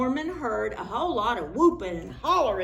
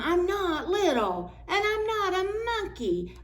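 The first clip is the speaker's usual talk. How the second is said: whiny moan; 10 semitones higher than usual. Acted. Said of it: "moaned Jim".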